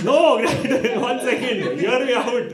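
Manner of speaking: high, loud comic pleading; hammed-up frantic protest; pleading son. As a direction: cut in desperately to be heard. Son pleading with dad on phone.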